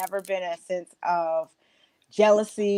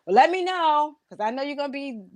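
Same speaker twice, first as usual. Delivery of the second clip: put-on much higher, fast, eager girlfriend voice; hyped friend; 9 semitones higher, faster than usual. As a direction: rush it out like you can't wait to back her. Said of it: "it's just like".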